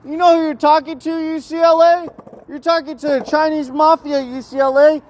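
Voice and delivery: very high-pitched mock Chinese-accented threat; attempted Chinese accent. Pretend mafia boss ordering UCLA around, high mock Chinese accent. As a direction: taunt in a badly faked high Chinese accent. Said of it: "I don't think I could do a Chinese accent".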